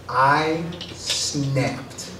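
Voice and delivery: ordinary talk, nothing marked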